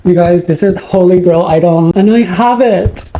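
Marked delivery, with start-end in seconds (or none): none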